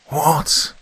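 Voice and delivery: high, startled shout; shouted disbelief. A narrator's reading. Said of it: "shouted Admiral Sir Radabar".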